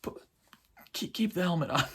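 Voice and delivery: hushed mock first words, halting; Grogu. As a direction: stammer it quietly as a little creature. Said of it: "first time Grogu speaks".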